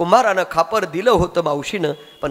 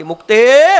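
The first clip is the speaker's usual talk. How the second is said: loud high call across a distance; 5 semitones higher, 9 dB louder, slower than usual. Acted. Sopan calls his sister from afar, loud call. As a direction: call her name from far away.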